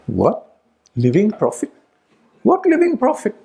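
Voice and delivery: retold disbelief rising high on the last question; incredulous surprise. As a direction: mimic her shock at the news. Mother shocked at hearing "living prophet.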